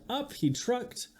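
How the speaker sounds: usual speaking voice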